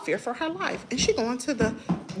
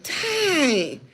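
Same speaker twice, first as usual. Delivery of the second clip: retelling her reaction to Peach, exasperated and higher; incredulous; 5 semitones higher than usual. Retold or imitated. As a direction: drag out the shock. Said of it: "so I'm like".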